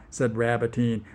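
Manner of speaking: ordinary delivery, nothing marked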